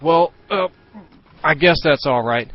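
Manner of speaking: mock the dad's caving, lightly voiced; defeated father. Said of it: "his father's like".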